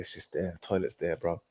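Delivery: soft, low, offhand answer; quiet retold reply. Telling Drake where the toilet is, quietly.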